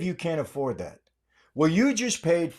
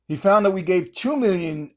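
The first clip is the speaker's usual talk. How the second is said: ordinary talk, nothing marked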